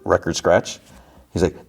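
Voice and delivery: ordinary delivery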